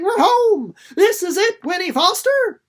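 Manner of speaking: high, loud, joyful shout of arrival; excited cry. A narrator's reading. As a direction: call out thrilled to be home. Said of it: "suddenly Jessie was crying".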